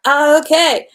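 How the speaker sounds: usual speaking voice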